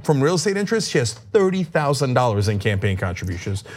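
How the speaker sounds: normal talking voice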